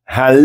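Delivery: "Hal" stopping and holding on the L; held L. Shown as a demonstration. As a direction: sustain the L of "Hal".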